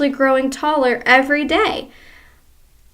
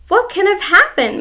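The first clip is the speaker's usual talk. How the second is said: put-on puzzled old wife; 8 semitones higher, 4 dB louder than usual. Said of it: "Missus Twit said".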